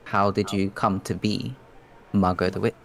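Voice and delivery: ordinary delivery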